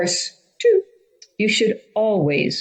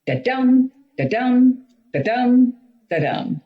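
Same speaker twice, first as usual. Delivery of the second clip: da-DUM chanted, stress on the second beat; iambic beat; 7 semitones higher than usual. Shown as a demonstration. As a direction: tap out the iambic rhythm, da-DUM. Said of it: "a music beat that goes up and down".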